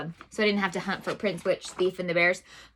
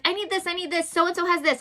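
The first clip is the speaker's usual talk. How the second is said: put-on whiny kid; 8 semitones higher than usual. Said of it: "my kids start to be like".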